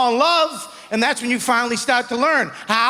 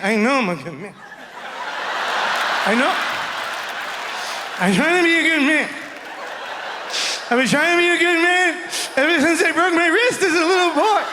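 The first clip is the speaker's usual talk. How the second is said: tearful confession; 4 semitones higher than usual. Acted. Man finally opening up.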